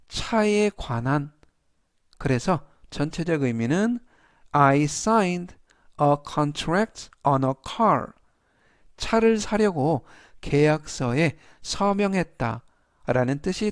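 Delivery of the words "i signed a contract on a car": a contract on a car, spoken very slowly; each word laid out slowly for learners; slow dictation. Shown as a demonstration. Teacher reads example sentence slowly to students. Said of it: "teacher reads model sentence slowly".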